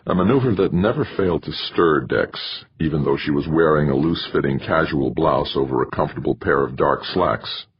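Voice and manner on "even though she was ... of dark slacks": usual speaking voice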